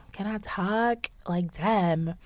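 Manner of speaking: ordinary talk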